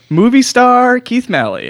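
high, slow showbiz announcement; hyped host intro. Acted. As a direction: sell him like a star.

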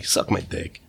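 soft, breathy muttered insult; quiet throwaway jab; muttered aside. Real, not acted. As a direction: mutter it under your breath.

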